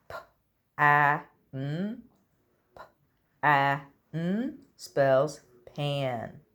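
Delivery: P-a-n, each sound stretched and separate. Shown as a demonstration. Teacher sounding out a word for children to guess. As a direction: sound out every letter slowly. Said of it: "I'm going to sound out the words".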